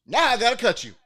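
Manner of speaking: hammed-up comic threat from a movie; Bernie Mac threat. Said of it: "He goes".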